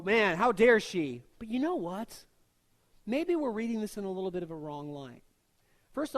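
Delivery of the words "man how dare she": louder, slow indignant huff. Retold or imitated. Churchgoers judging the mother, slow indignant huff. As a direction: scoff at her nerve, drawn out. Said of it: "and we go".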